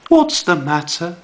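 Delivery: a light worried mother; concerned mum. Shown as a demonstration. Mum asking upset Bear, lightly voiced concerned mum. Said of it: "asked Mum".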